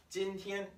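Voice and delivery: "今天" high with no waver; flat high 今天. Shown as a demonstration. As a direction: hold "今天" high and level. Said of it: "they do not waver, they stay flat".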